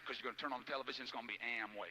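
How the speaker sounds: ordinary talk, nothing marked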